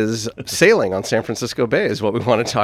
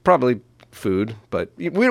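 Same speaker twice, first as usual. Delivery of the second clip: ordinary talk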